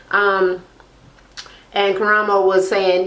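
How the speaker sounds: ordinary delivery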